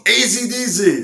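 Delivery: high, loud hammed-up disbelief; amazed exclamation. Singer reacting to the band's name, in exaggerated excitement. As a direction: cry it out in mock astonishment.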